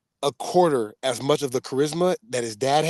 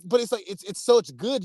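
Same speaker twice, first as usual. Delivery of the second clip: normal talking voice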